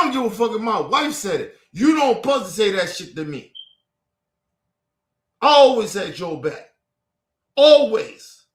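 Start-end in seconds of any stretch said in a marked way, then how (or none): 5.4-8.4 angry shout. Real, not acted. Insisting he always had her back, shouting angrily. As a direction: belt it out to close the argument.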